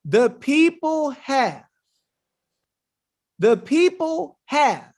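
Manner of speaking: slow, deliberate quote; slow dramatic reading of the text. Shown as a demonstration. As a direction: read it out slowly for weight. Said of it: "slow reading".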